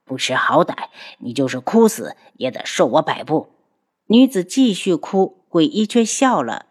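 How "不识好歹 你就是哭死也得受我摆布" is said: lower, glaring cold threat; angry ghost doctor. Acted. Ghost doctor glares and threatens the crying girl, lower and cold. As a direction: spit the threat at her. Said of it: "鬼医怒瞪着女子".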